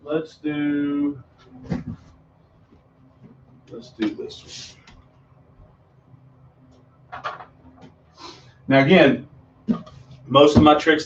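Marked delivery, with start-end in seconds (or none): 0.0-7.2 hushed aside. Real, not acted. Magician drops to hushed aside.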